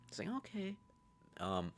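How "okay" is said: soft, high put-on squeak; high-pitched okay. Reply to the friend's report, soft high imitation. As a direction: lift it high and small, falling at the end.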